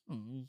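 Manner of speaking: very soft knowing hmm; suggestive hum. Real, not acted. Reader hints at the food fight, soft suggestive hmm. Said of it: "raising my eyebrows at that".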